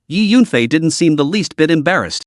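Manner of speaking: plain reading voice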